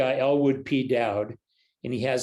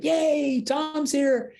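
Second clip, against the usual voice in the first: put-on high, squealing joy at the new baby; a mother thrilled to greet her newborn; gushing, excited mom voice; delighted mom; 14 semitones higher, much slower than usual. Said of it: "Your mom can't wait to see you".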